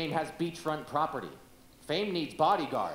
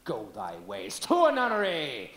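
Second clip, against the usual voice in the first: building Shakespeare declaim; 3 semitones lower, 4 dB louder than usual. Acted. Rehearsing Hamlet, rising declaim.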